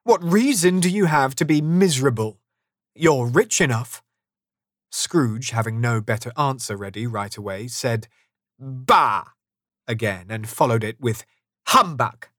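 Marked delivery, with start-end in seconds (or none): 0.0-4.0 lively, higher-pitched comeback; cheerful retort. A narrator's reading. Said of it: "the nephew replied cheerfully". 8.6-9.3 curt, louder dismissive exclamation; grumpy scoff. A narrator's reading. Scrooge has no better answer. 11.7-12.3 higher, louder dismissive exclamation; grumpy scoff. A narrator's reading. Scrooge dismisses nephew again.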